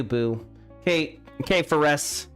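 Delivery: ordinary delivery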